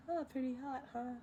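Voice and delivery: soft, breathy cocky dragon; Eddie Murphy impression. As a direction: redo it as Eddie Murphy's Mushu. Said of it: "I love Eddie Murphy".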